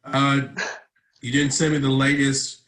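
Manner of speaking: ordinary talk, nothing marked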